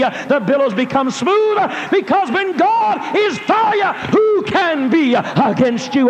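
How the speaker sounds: high, loud, rhythmic preaching with uh-fillers. Real, not acted. A preacher telling the congregation of God's power, full-force preaching. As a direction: preach it over the music.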